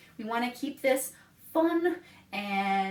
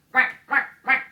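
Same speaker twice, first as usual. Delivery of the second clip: loud playful duck quack; 8 dB louder than usual. Pretend. Duck noise in animal song.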